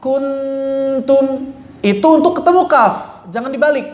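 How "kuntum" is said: kuntum read with the tongue pressed; wrong kuntum. Shown as a demonstration. Teacher showing how not to read kuntum. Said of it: "Jangan dibaca".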